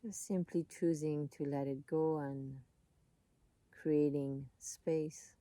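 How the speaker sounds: calm, unhurried voice with long pauses; soothing meditation guide, leaving space between phrases; guided meditation. Real, not acted.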